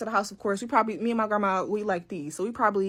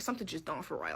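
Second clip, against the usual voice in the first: much softer, dropping low, gut feeling; uneasy murmur; 5 semitones lower, 11 dB quieter than usual. Real, not acted. Narrator sensing something wrong, quietly uneasy. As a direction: say it under your breath, suspicious.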